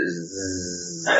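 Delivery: a long, soft, buzzing "ز" with no vowel; sustained Z sound. Shown as a demonstration. Teacher demonstrating the raw sound leaving the mouth, sustained Z. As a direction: hold "ز" as one steady quiet hum.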